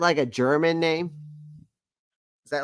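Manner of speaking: ordinary talk, nothing marked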